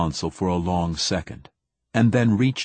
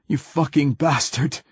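higher, strained angry gasp; gasped curse; 6 semitones higher than usual. Acted. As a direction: spit the curse out breathless. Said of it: "he gasped".

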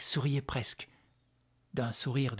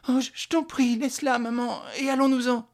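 put-on high, stammering child's plea; pleading boy; 11 semitones higher, 4 dB louder than usual. Son begs mother to leave, pleading. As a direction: beg her in a high, urgent voice.

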